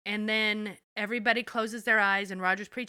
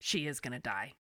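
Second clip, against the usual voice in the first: softer, lower, grave and hushed; quiet grim verdict; 7 semitones lower, 7 dB quieter than usual. Retold or imitated. Claire telling the family the widow has minutes, hushed. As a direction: break it gently, barely above a murmur. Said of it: "examines her and is like".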